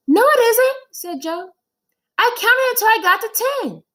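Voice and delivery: put-on high, loud protesting kid; child Joe. Boy insists to his teacher he counted right, high and indignant.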